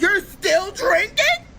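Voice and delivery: high, slow, whiny disbelief; shocked squeal. Retold or imitated. Girlfriend shocked boyfriend still drinks, high whine. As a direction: drag it out in shocked despair.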